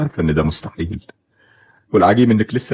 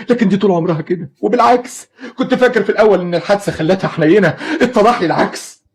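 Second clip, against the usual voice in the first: high, raised accusing voice; bitter anger; 11 semitones higher than usual. Acted. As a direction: lash out at her cruelty. Father raging about his wife.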